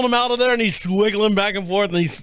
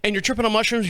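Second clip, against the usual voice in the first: ordinary delivery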